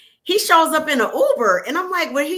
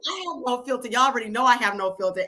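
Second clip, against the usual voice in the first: ordinary talk, nothing marked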